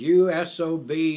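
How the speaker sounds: high, very loud insult; retold angry bellow; imitated raving. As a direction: throw the insult out for the whole market to hear. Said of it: "Sam started raving".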